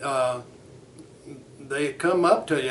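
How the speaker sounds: ordinary delivery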